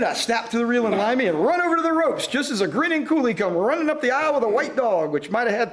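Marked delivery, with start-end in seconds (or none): none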